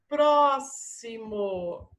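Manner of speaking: "Próximo" very slow, long syllables and clear s. Shown as a demonstration. Teacher placing it under SS, "Próximo" slowly sounded out. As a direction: dictate "Próximo" as pro-si-mo. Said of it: "Som de S S".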